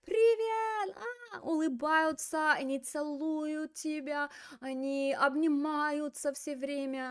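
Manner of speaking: high, drawn-out effusive gushing; gushing friendliness. Acted. Teacher imitates very welcoming Brazilians greeting everyone, high gushing delight. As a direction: greet like an overjoyed friend, hammed up. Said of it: "время говорят".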